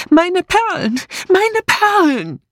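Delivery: high-pitched wail of fear and rage, drawn out at the end; anguished cry. A narrator's reading. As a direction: cry out in agonised panic. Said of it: "Voller Angst und Wut rief Letitia".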